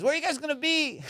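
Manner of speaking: high-pitched pleading question; mock desperate plea. Buddy asking officers their whereabouts, mock desperate.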